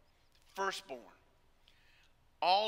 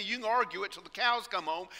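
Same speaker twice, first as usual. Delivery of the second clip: normal talking voice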